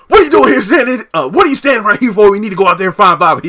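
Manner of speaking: loud, high, fast panicked yelling; freaking out. Retold or imitated. Donald yelling at Kyle to go find Bobby, frantic loud yelling. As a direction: blow up at him, screaming fast and high. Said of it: "Donald just freaks out".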